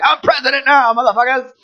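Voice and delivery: high-pitched gloating mock boast; exaggerated high victory cry; mock triumphant politician. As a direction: crow it like a power grabber. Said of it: "and then she's like".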